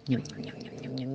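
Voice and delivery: soft, low nibbling; munching noises. Acted. Storyteller plays the mouse eating the moon, munching noises. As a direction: imitate little bites, very softly.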